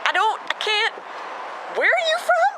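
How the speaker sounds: high, rising bewildered exclamation; exasperated, high-pitched puzzlement; baffled mock reaction. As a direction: act out being completely thrown. Acting out her accent confusion.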